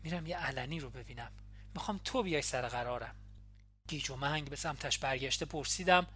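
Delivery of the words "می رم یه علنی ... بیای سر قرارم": hushed, quick murmur close to the ear; an intimate, secret aside; softly in her ear. A narrator's reading. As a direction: say it low and fast, just for her. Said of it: "در گوشم آرام گفت".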